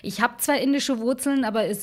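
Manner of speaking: ordinary talk, nothing marked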